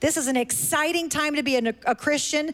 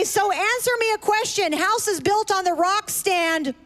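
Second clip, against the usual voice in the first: put-on high, bouncy kids' host voice; singsong puppet show host; 7 semitones higher than usual. Talking to the kids.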